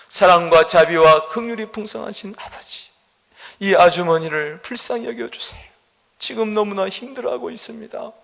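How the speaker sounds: fervent pleading prayer voice. Acted. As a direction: pray aloud to God for the woman.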